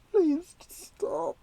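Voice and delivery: breathy, whimpering please stop; pleading whisper. Retold or imitated. Recalling his own reaction to the gory scene, whimpering plea. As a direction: beg it under your breath, sick. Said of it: "I'm just like".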